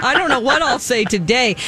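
normal talking voice

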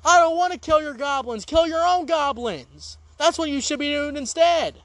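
fed-up refusal. Pretend. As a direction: refuse outright. Refusing to kill goblins.

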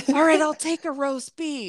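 a hint of oblivious demand; lightly voiced pushy customer. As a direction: imitate a customer barging ahead. Same retold pushy customer.

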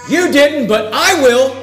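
high, loud contrast of "You" and "I"; boastful stress on "I"; emphatic I. Acted. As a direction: punch "I" in "but I will", self-important. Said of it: "meaning it is elevating himself".

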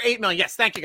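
ordinary talk, nothing marked

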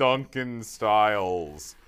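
slow, muddled fake British accent; drawn-out mock accent; bad foreign accent. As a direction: stretch the name in a silly foreign accent. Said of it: "best foreign accent".

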